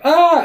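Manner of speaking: short high mock shriek; imitating a startled movie scream. Characters scream on seeing creature.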